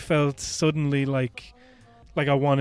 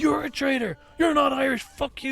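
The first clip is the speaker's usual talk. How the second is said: mock yelling back; 12 semitones higher than usual. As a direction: shriek it back at her in imitation. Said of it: "just yelling back at this woman".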